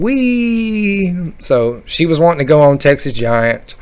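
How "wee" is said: put-on girl's wee. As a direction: imitate a girl's drawn-out ride cheer. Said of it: "she started saying".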